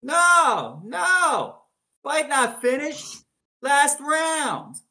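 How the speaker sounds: loud, high, drawn-out New York referee's shout; New York accent. Acted. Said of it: "in his, uh, New York accent".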